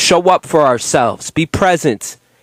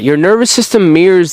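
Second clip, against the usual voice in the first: usual speaking voice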